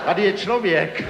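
high, plaintive call-out; calling for help. Retold or imitated. Stranded climber alerting passers-by, high call for help. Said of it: "nahlas se přidával".

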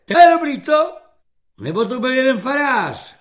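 high, loud bragging; boastful corporal. Acted. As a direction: brag about breaking recruits. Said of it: "chlubí se svými výchovnými úspěchy".

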